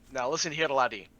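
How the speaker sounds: a hint of a Scottish brogue, calm and persuasive. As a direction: play the healer bargaining in a mild Scottish burr. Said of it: "in my best Scottish brogue".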